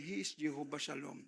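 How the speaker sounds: ordinary talk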